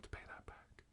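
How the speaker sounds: hushed, confiding aside; conspiratorial whisper. Acted. Host telling Bill a secret about hush money, whispered.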